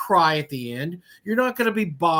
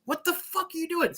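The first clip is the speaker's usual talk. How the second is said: much higher, soft incredulous question; 9 semitones higher, 6 dB quieter than usual. Real, not acted. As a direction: ask in disbelief. Shocked reaction to mock writer.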